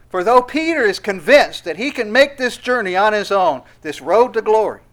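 rising sermon crescendo; preaching at full force. Acted. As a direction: hammer the point home.

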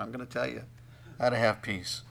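ordinary talk, nothing marked

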